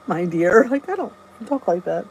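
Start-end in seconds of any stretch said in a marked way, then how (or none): 0.1-0.7 put-on a touch of a prissy old-fashioned voice, lower; lightly mocking her own words; mock prim my dear. To the viewers, mocking her own my dear, mildly prim. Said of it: "I sound like".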